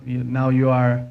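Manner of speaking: ordinary delivery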